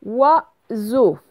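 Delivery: two clearly separated syllables. Shown as a demonstration. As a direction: split the word for pupils. Correction, découpe le mot en syllabes.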